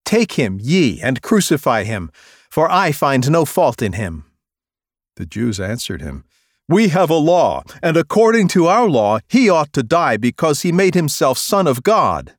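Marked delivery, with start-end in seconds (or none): 0.1-4.3 higher, louder dismissal; loud raised rebuke; Pilate exasperated. Acted. Pilate answers the priests. 6.7-12.4 much higher, louder accusation; loud insistent accusation; accusers insisting. Acted.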